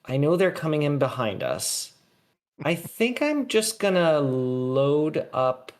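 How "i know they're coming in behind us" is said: ordinary delivery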